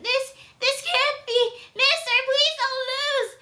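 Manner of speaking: high, whiny, mock-tearful little boy; mock crying kid. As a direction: whimper it like a kid about to cry. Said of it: "he's just crying".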